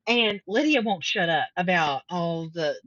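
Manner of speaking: usual speaking voice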